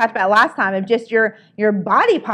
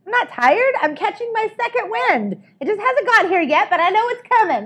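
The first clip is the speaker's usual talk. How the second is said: much higher, bright pep; energized; 9 semitones higher than usual. Shown as a demonstration. Coach showing the class an energized reframe, eager and high. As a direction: sell the second wind with eager pep. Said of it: "it's like".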